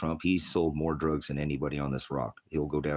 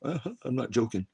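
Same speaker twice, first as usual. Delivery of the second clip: laughing; 10 semitones higher, 3 dB louder, slower than usual. Real, not acted. Laughs while insisting.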